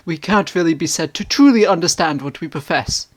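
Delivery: ordinary delivery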